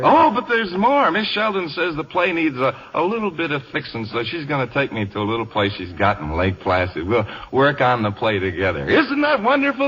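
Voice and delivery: tipsy. Acted. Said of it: "I suppose I'm a little drunk".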